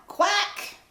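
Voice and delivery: nasal-free bright duck call; high loud duck quack. Acted. Duck calling out across the pond, loud quack.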